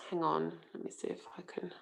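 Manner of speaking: very quiet, half to yourself; absent-minded murmur. Real, not acted. Host checking the controls mid-session, murmured to herself. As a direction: murmur it while busy checking something.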